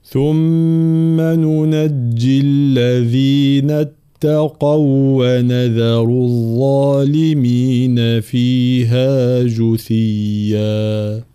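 very slow, drawn-out tajweed chant; Quran recitation. Acted. Teacher reciting the verse for students, slow chant. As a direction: stretch each vowel in melodic recitation.

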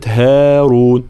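slow "هارون" with thin front a; light ha. Shown as a demonstration. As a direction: draw out "هارون", haa kept light. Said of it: "ترقيق الهاء". (هارون بترقيق الهاء)

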